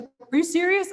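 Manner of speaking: high, loud incredulous outburst; shocked and offended, voice shooting up; outraged disbelief. Retold or imitated. Coworker reacting to the manager's refusal, loud outraged disbelief.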